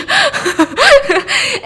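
high, loud burst of laughter; high-pitched giggling outburst; real laugh. Real, not acted. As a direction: crack up at your own remark. Laughs after cursing twenty twenty.

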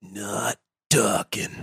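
low slow gruff mutter; Macho Man impression. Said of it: "Macho Man's like".

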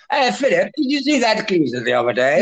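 high-pitched mock royal chat; mock British royal asking a casual question; Buckingham Palace royal. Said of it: "you'd hear in Buckingham Palace".

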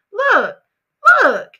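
high, loud "look"; insistent. Real, not acted. Demanding the audience listen up. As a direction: grab their attention. Calling for attention.

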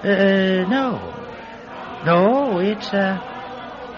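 high, stalling denial; hesitant lie. Acted. Cap thief asked about the tweed cap, hesitant high denial. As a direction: draw out the ums, unconvincingly innocent.

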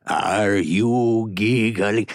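very slow, drawn-out theatrical question; Ricardo Montalban impression. Asking Richard if he is giggling, slow Montalban voice. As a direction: stretch it out with grand deliberation. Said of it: "one of my favorite impressions".

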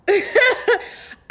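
high giddy burst of laughter; happy laugh of disbelief; excited high laugh. Real, not acted. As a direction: laugh out the joy.

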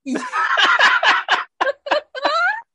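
high burst of laughter; cracking up loudly. Real, not acted. Laughing at Leprechaun joke.

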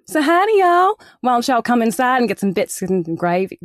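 Southern American accent, high loud greeting easing down into the invitation; best American accent. Shown as a demonstration. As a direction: call out a folksy American Southern welcome. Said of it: "I'll say it in my best American accent".

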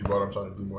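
talking between sips; while sipping a drink. Real, not acted. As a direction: mouth still wet from a drink. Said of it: "Sorry, I'm drinking my Fiji".

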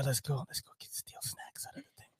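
barely audible sneaky whisper; acted whisper. Joking about stealing plane snacks, conspiratorial whisper. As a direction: whisper it as if plotting a petty theft. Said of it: "I would have been like this".

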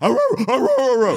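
high yapping dog barks. Retold or imitated. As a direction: bark like a little dog. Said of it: "Fucking bark".

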